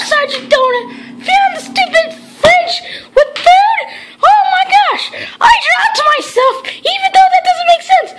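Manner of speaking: high, frantic cartoon voice with laughter; frantic shrill voice, laughing; shrieking cartoon character. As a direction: hysterical squeaky toy character panicking.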